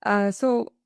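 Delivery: ordinary delivery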